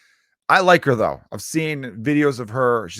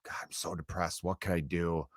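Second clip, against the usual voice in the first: a hammed-up mopey whisper, much softer; mock depressed whisper; 5 semitones lower, 12 dB quieter than usual. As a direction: sigh out the words like a mock sad sack. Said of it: "if this is his thing where he's like".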